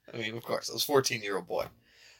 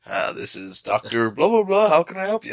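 raised pitch, climbing through the digits; repetitive phone greeting; 7 semitones higher, 4 dB louder than usual. Retold or imitated. TV character answering his phone, sing-song rising greeting. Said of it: "every time he answers his phone, he says his phone number".

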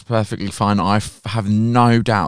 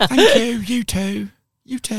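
awkward thanks; 12 semitones higher, slower than usual. Retold or imitated. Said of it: "I was just like".

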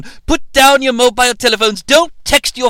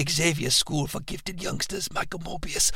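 whispered name; 4 semitones lower, 14 dB quieter than usual. Pretend. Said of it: "Oh no, I said it out loud".

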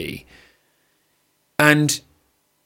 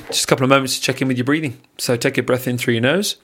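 guided breathing. Acted. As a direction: talk them through each breath, unhurried. Leads a breathing exercise.

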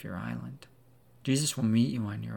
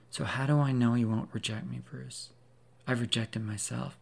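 ordinary talk

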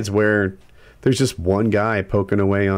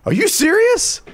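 very high, louder disbelieving challenge; incredulous; 4 dB louder than usual. Retold or imitated. Contractor squaring up to homeowner, incredulous. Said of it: "He'd be like".

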